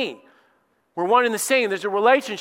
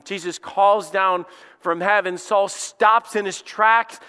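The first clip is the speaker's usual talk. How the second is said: ordinary delivery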